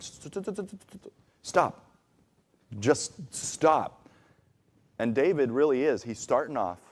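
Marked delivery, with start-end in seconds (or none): 0.0-4.1 stammered stop. Retold or imitated. Cutting off a worrier's rapid fire, stammering then firm. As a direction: trip over the word before finally landing it. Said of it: "it's like".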